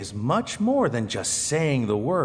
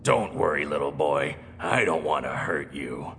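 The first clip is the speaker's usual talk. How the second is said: low growly voice; 9 semitones lower than usual. Acted. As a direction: growl it low like a big gentle bear. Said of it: "a low, growly voice like this".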